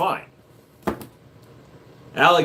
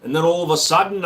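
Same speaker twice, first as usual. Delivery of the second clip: ordinary talk, nothing marked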